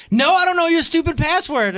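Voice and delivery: put-on high-pitched kid, fed up; exasperated daughter. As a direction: imitate a child snapping back at Dad. Voicing daughter's exasperated reply.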